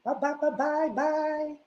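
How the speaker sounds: high, slow mocking singsong; hammed-up high taunting voice; mock sing-song. Mocking Tierra's sponsor performance.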